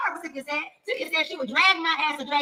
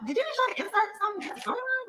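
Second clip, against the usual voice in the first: incredulous thought; 10 semitones higher, 3 dB quieter than usual. Retold or imitated. Retold inner thought.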